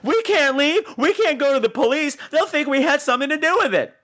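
mock panicky Tommy. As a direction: plead it in a hammy fluster.

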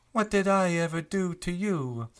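mock-hurt protest; innocent Loriana. GM as Loriana pleading with Q, mock-hurt. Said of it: "She turns to Q and says".

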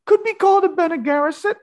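squeaky high joke voice; silly high voice. Acted. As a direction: jump way up into a silly falsetto. Joking about a witch cabal.

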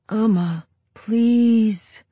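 put-on whiny kid begging. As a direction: drag out the plea, whining. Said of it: "Ike asks in his whiny voice".

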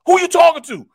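high, loud imitation of an angry teammate; fired-up mad. Peasy jumping up at his heckler, mad acted shout. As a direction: yell each repeat as a furious challenge. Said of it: "He got so fired up and so mad".

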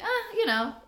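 dismissive quote. Retold or imitated. Voices character brushing off the question.

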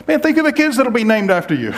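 high, loud mock cheer; mock hearty reassurance; mock upbeat platitude. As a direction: ham up a hollow comfort. Mock consolation quote.

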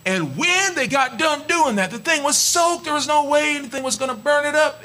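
high, rising preacher's fervor; preaching. Real, not acted. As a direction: build the miracle with fervor. Preaching climax.

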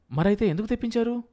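high, quick, exasperated question; exasperated challenge. A narrator's reading. Wife challenges husband over the ordered drinks, exasperated. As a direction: demand an explanation, quick and high.

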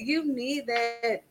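hushed, slowed echo of the point; soft repeat. Real, not acted. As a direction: let "You need that" sink in quietly. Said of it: "like, you need that".

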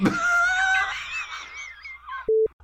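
shrieking burst of laughter. Real, not acted. Cracks up at own joke.

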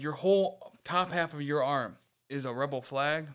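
usual speaking voice

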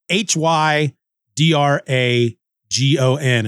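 ordinary talk

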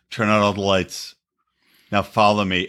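low-pitched imitation of a romantic show-off; mock seductive fiancé. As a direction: play it up low, for the camera. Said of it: "I like how he's like".

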